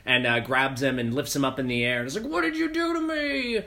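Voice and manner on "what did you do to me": monster roar. Retold or imitated. Mutated soldier lifts Boyce up.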